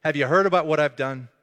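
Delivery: mock-affronted rebuke; rebuking. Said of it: "Jesus is kind of rebuking him".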